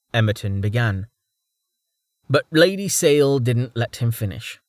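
ordinary delivery

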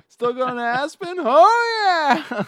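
high, loud cartoonish voice; high mock voice. Quoting the movie's Aspen exchange, high loud mock voice.

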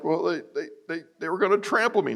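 high, fast, flustered excuse; stammering excuse. Retold or imitated. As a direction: blurt it out defensively. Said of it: "I'm like".

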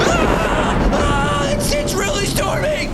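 high-pitched mock panic; panicked cries. Captain Peter panics as the storm hits the boat.

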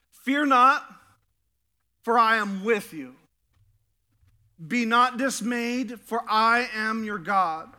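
loud, slow proclamation; scripture proclamation. Acted. Preacher proclaiming Isaiah to the congregation, loud and slow. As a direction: declare each promise slowly.

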